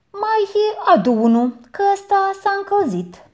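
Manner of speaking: high, loud order; aunt complains the water is warm; demanding aunt. A narrator's reading. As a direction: send him off again, peevish.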